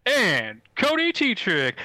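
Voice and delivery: slow, loud, high ringmaster call. Acted. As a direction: draw out the name grandly. Announcer introduces host.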